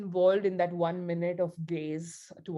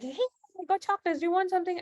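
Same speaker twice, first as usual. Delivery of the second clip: much higher, bright offering voice; cheery coworker; 11 semitones higher, much faster than usual. Retold or imitated. Coworker offering chocolates, voiced high and cheery. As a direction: chirp it like a friendly colleague.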